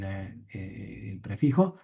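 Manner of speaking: normal talking voice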